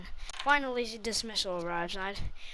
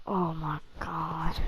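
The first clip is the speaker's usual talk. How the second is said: whispering; 3 semitones lower, much slower than usual. Retold or imitated. Teacher reacts, hand on forehead.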